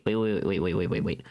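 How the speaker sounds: ordinary delivery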